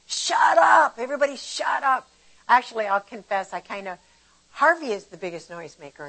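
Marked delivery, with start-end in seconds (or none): 0.1-2.1 high, loud mock yell of shut up; mock shout. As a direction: act out losing patience with a yell. Acted outburst in retold story.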